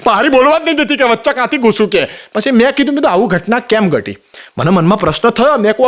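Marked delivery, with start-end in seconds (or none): none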